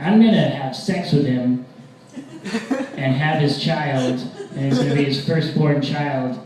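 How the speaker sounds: loud, emphatic escalating vow; ranting mock threat. Boyfriend ranting revenge to girlfriend, loud mock fury. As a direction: hammer out each step of the revenge.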